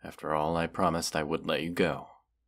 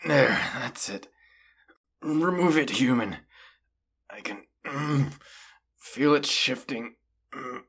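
higher and louder, strained as the arrow moves; strained in pain; 8 semitones higher, 4 dB louder than usual. Acted. Wounded dragon while the human pulls the arrow, strained. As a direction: grit through the pain of the arrow shifting.

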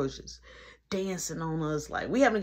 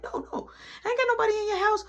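high, rapid-fire mock protest; hurried denial; 3 dB louder, much faster than usual. Tipsy guy assuring mom nobody is in her house, hurried high denial. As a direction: rush it out, pitched way up.

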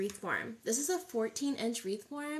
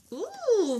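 delighted ooh; 10 semitones higher, 6 dB louder than usual. Real, not acted. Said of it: "I'll bet you those are gorgeous".

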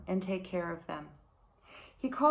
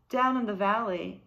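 ordinary delivery, nothing marked

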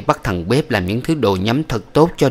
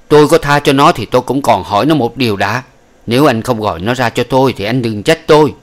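stern threat; 3 semitones higher, 6 dB louder than usual. Acted. Angry old woman threatening.

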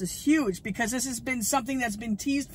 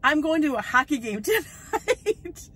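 laughing through the line, higher and slow; 5 semitones higher, 4 dB louder than usual. Real, not acted. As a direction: giggle it out, amused at the bad timing. Laughs about hockey game conflict.

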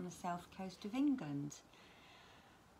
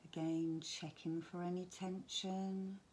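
guided relaxation. Acted. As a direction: gently talk them down towards sleep, phrase by phrase. Leads a bedtime relaxation.

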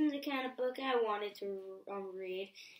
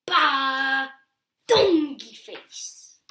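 slow, loud goofy gibberish; silly nonsense sounds; 2 semitones higher, 7 dB louder, slower than usual. Acted. Reader making silly sounds for the child. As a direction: ham up the nonsense words. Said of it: "make silly sounds like".

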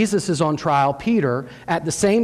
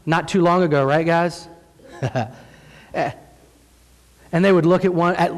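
ordinary delivery

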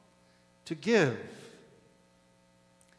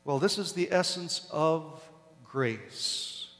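ordinary delivery

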